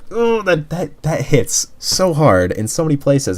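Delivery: high, slow, moved gush; imitating her own high, overwhelmed gasp; gushing reaction. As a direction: squeal it out like it hit you hard. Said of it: "And I was like".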